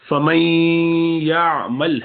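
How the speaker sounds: فَمَن يَعْمَلْ with the nun hidden into a nasal hum; nasal ghunna on famay. Shown as a demonstration. Quran teacher demonstrating to students: nasal ghunna on فَمَن يَعْمَلْ. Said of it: "بس فَمَن میں ذرا غنہ، ناک میں آواز گھمائیے".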